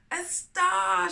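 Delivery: high, loud delighted cry; cried out. A narrator's reading. Little rocket spotting another star, crying out excitedly. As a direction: shout it, thrilled to spot it. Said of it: "she cried".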